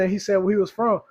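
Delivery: ordinary delivery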